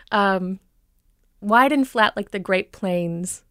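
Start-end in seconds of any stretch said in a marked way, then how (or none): none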